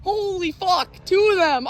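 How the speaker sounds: high, thrilled outburst; excited exclamation. Real, not acted. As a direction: blurt out in disbelief. Double lightning strike.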